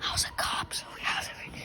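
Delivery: breathy unvoiced whisper. Real, not acted. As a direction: whisper it secretly. Whispered aside.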